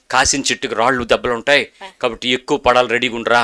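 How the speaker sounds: fatherly advice. Retold or imitated. Pastor answers man fondly.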